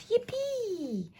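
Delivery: a very high whoop of delight; cry of joy. A narrator's reading. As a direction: shout it out gleefully. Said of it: "the trees cried out".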